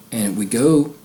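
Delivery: usual speaking voice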